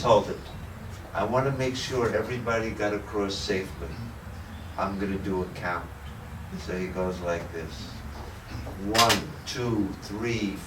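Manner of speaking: quiet head monk. Retold or imitated. Head monk counting his monks, quiet and low.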